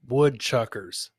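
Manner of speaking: ordinary talk, nothing marked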